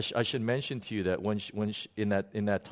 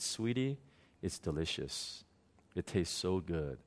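much softer, gentle and loving; tender comfort; 5 semitones lower, 8 dB quieter than usual. Retold or imitated. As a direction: soothe a crying child. Jesus comforts the little girl.

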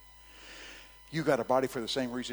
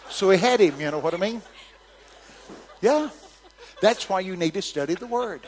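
laughing; 8 semitones higher, 9 dB louder than usual. Real, not acted. Laughing at his own joke.

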